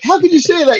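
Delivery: high outraged Michael impression; mock shock, pitched well up. Michael to Archie, high mock outrage. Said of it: "Michael's like".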